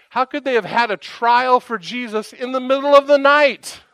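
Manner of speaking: high-pitched outraged questioning, building to a loud peak; riled up. Retold or imitated. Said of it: "just getting riled up".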